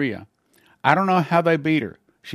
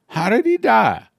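sudden high, louder question; startled question; 8 semitones higher, 5 dB louder than usual. Retold or imitated. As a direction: blurt it out in disbelief. Hearing the colonel died.

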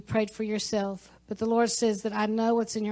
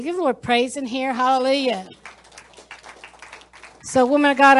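rally speech; 3 semitones higher, 8 dB louder than usual. Real, not acted. Preacher calling out praise.

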